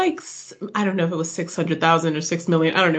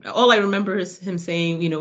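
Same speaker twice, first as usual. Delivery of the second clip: normal talking voice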